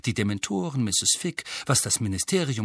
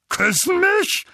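very high, loud yelp of disbelief; Onkel Vernon, aghast; 5 dB louder, slower than usual. A narrator's reading. Uncle reacts to being kissed by Dementors, aghast. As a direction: shriek the question in outrage. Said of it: "mit leicht vorquellenden Augen".

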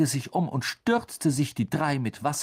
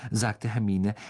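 plain reading voice

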